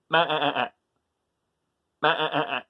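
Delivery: bleating ma-ah-ah like a goat. Shown as a demonstration. Reading to kids, imitating the goat's bleat. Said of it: "he makes the sound".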